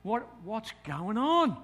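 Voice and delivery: anxious thought. Retold or imitated. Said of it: "I'm thinking".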